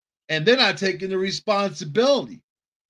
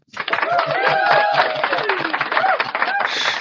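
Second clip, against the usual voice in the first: loud high cackle; 5 dB louder than usual. Real, not acted. Laughs at own joke.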